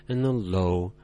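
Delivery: "low" held deep, low and flat. Shown as a demonstration. Teacher reminding learners to hold the low tone steady. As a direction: sink "low" and keep it level. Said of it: "remember to hold your tones".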